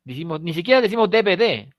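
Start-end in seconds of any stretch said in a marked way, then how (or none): 1.1-1.7 "D V D" as de-be-de; Spanish e vowels in "D V D", de-be-de; mistaken letter names. Shown as a demonstration. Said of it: "también sería un error".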